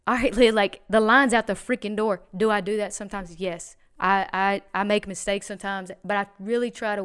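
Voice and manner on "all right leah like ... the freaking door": louder, fed-up retort at wife; imagined irritated reply, pushed louder; exasperated outburst. Retold or imitated. To his wife Leah while the store is slammed, exasperated and louder. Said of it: "I could have easily said".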